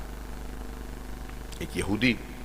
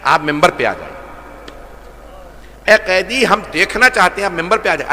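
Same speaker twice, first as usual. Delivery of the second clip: high, loud calling out of the crowd; crowd crying out; 7 semitones higher, 6 dB louder than usual. A narrator's reading. Crowd shouting to the captive Imam, loud and high. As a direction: call to the captive like a whole crowd. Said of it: "مجمع چیخنے لگا".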